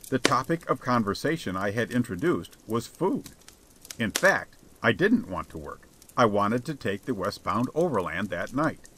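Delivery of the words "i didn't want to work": ordinary delivery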